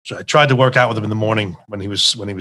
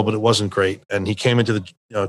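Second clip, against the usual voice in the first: ordinary delivery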